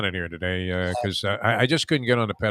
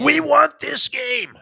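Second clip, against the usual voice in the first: high, slow, hammed-up coach yell; Dan Campbell; 7 dB louder, much slower than usual. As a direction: bellow it like a fired-up coach. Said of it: "Dan Campbell, can you imagine?".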